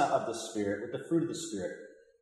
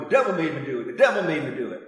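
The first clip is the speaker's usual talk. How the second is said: louder hammed-up comic catchphrase; Flip Wilson catchphrase; 6 dB louder, faster than usual. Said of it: "Flip Wilson used to walk around going".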